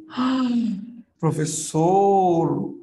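dragged, slow "¡Ay, profesor!" after a gasp; mock gasp. Teacher playing an amazed student, slow mock gasp.

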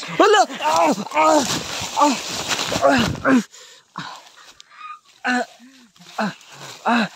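short high loud screams; screams of fright. Real, not acted. Vlogger screaming while the aswang attacks him. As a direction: cry out as if struck.

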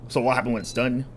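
normal talking voice

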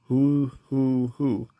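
hooting ghostly wail. Acted. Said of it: "wailed the ghost".

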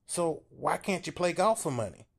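small man pushing hard; Paul urging Bill to look at the kids, high and loud; timid Paul insisting. A narrator's reading.

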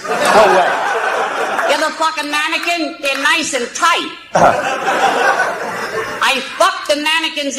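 crude puppet voice, cackling; squeaky ventriloquist dummy. Acted. Ventriloquist act, dummy speaking.

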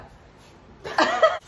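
high, loud burst of laughter. Real, not acted. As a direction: shriek with laughter. Bursting out after joke.

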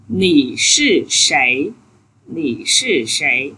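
slow, careful "Nì sư sảy"; slow model Chinese. Shown as a demonstration. Teacher modeling the question for students, slow and careful. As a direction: sound out Nì sư sảy slowly for the class. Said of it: "thì mình sẽ hỏi sao?".

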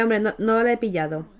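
normal talking voice

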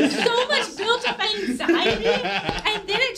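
high and loud, laughing through the words; bursting with laughter as she explains. Real, not acted. Laughing while telling friends about audition nerves.

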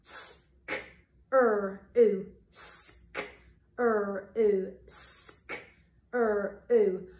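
sk whispered unvoiced, then er and ooh spoken, with pauses between; sounding out screw. Shown as a demonstration. Teacher segments screw for the class. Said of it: "segment it not once, not twice, but three times".

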